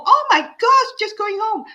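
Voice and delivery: high, gushing exclamation; excited gush. Real, not acted. Host reacts to a commuting viewer, gushing. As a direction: squeal with delight at the message.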